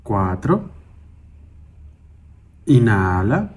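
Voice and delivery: slow single-word cues, each vowel drawn out; calm, measured breath counting with long silences; guided breathing meditation. Acted. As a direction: pace someone's breathing, stretching each cue unhurriedly.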